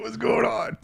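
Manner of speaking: high, puzzled cartoon voice; dazed turtle voice. Turtle waking from hibernation, high confused voice. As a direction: wake up confused and squeaky. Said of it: "he's like".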